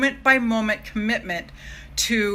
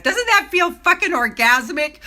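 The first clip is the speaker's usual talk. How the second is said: higher and louder, thrilled; excited; 8 semitones higher, 6 dB louder than usual. Real, not acted. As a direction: burst out with delight after chanting. Thrilled after the affirmations.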